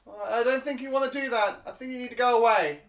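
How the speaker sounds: squeaky guard warning them off; kobold voice. Acted. Kobold guard telling the party to leave: high squeaky voice. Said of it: "this voice goes".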